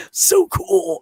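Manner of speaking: high, giddy squeal of delight; excited squeal. Retold or imitated. The psyched guide gushing over the photos, high giddy squeal. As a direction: squeal it like a thrilled kid.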